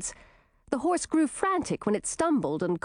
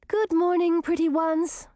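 sweet greeting; 9 semitones higher, 3 dB louder than usual. Acted. Girl greets her birds.

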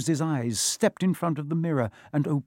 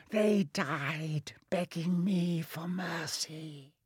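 slow, hushed, raised villain voice; sinister whisper; 4 semitones higher, 7 dB quieter, much slower than usual. A narrator's reading. The face taunts Harry about his parents, sinister whisper. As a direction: sink to a cruel near-whisper.

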